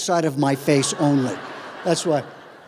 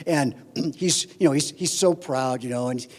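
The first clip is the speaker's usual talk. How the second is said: ordinary talk, nothing marked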